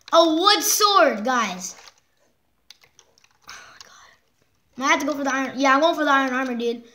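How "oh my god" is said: breathless, slow whisper of disbelief; hushed oh my God; awed whisper. Real, not acted. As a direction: whisper it, stunned. Gaming vlogger whispers in shock.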